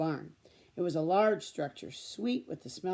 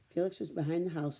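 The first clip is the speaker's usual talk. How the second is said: usual speaking voice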